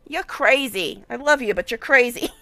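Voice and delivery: ordinary delivery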